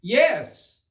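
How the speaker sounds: high eager shout; Alice shouting. A narrator's reading. Alice answers the queen, eager shout. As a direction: call out yes. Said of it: "shouted Alice".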